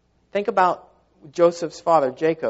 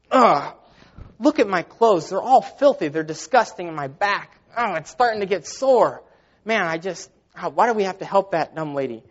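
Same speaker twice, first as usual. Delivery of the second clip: louder, higher-pitched grumble of disgust; mock complaining; 3 semitones higher, 4 dB louder than usual. Second monk griping to his companion after the river crossing, complaining. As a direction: act out a fed-up monk griping. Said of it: "He just was complaining".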